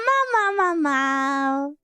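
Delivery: normal talking voice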